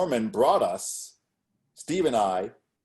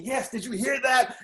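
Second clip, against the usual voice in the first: excited mock scream; 12 semitones higher than usual. Poet cheering the performers on, excited mock scream. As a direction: imitate a thrilled listener's screamed approval. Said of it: "She's screaming".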